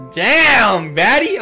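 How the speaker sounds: loud, drawn-out "damn" climbing high; hyped exclamation. Real, not acted. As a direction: whoop it out in admiration. Hyped reaction to character closeup.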